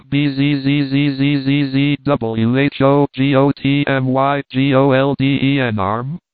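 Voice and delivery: buzzing hollow ghost voice; ghostly buzzing wail. Acted. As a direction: drone it like a ghost in the wind. Ghost voice in the wind.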